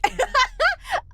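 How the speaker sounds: squealing burst of laughter; high laugh. Real, not acted. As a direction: let out a high giggle. Laughing at own slip.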